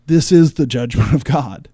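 IS stressed in a loud, falling proclamation; loud declaration. Shown as a demonstration. Host urging the church to preach it, loud declaration. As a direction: proclaim it loud and final, like a pulpit. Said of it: "the church needs to start saying".

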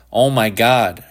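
ordinary delivery, nothing marked

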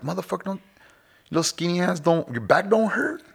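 mock-amazed, drawn-out question; incredulous ribbing. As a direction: needle the skinny young barber. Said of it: "I'm like".

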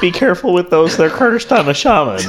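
high, hammed-up retelling; high-pitched recap. Screener repeating shaman's words to friends, high-pitched imitation.

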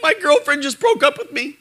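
put-on high, whimpering teenage boy; mock teen crying. Teen boy telling of his breakup, mock crying. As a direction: imitate a boy sobbing over a breakup. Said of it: "some teen boy crying".

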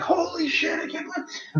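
very high retold cry of astonishment; hammed-up amazed exclamation; amazed disbelief. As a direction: act out stunned discovery, pitch shooting up. Retells finding the lost film.

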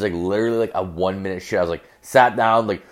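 ordinary delivery

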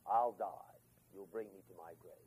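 low, soft, broken-off lament; grieving father. Retold or imitated. Jacob to his sons, hushed and grieving over Benjamin. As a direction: sink to a hushed moan of grief.

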